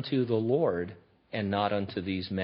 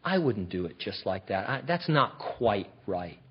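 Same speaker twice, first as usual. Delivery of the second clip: a soft, sniffy little put-down mumbled under the breath; imitating a faultfinder who does nothing; mock critic; 3 semitones lower, 8 dB quieter than usual. Idle critic sniffing at the one doing the work, softly. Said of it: "look at somebody and say".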